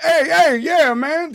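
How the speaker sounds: loud, high friendly hail; loud greeting. Retold or imitated. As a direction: call out cheerfully. Acting out greeting the cab.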